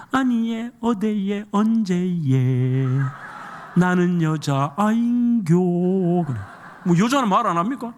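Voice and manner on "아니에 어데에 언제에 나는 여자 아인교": slow, stretched Gyeongsang dialect lines. Pretend. Pastor singing the shy girl's answer in Gyeongsang dialect, stretched. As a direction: drag out 어데에 and 언제에 like a Gyeongsang girl. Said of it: "경상도 말로 하면".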